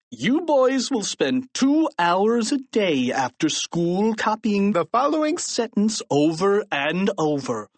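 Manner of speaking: growled order. A narrator's reading. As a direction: growl the punishment at the boys. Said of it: "growled Mister Krupp".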